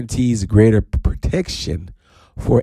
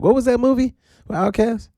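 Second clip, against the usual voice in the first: lightly voiced curious fan, higher; mock listener asking; 13 semitones higher than usual. A blog reader asking about the Outkast movie, mock curious. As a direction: ask it like a clueless reader. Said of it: "and be like".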